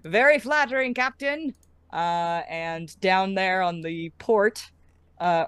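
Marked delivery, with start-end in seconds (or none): 0.0-1.5 high put-on voice, teasing the captain; visitor's voice. Visitor replies to the captain, high put-on voice. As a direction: play the same high visitor.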